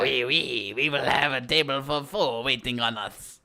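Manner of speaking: a light fake French accent; slow, hammy French accent. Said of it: "did a French accent".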